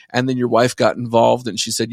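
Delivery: usual speaking voice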